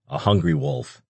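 plain reading voice

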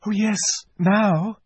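put-on very high excited girl; a delighted child; eager. A narrator's reading. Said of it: "said Lucy".

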